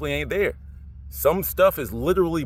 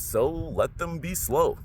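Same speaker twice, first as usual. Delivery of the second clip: slow; 4 dB quieter, much slower than usual. Real, not acted. Slow delivery.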